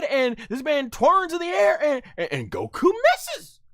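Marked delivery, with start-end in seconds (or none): none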